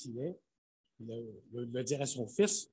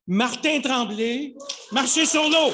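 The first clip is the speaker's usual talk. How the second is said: booming prize announcer; 8 semitones higher, 11 dB louder than usual. Acted. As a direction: proclaim the winner triumphantly. Announcing the winner.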